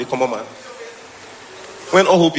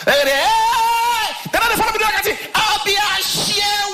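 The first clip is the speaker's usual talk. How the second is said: high, slow mock shout; slower than usual. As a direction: call out high and stretched. Shouting in a raised voice.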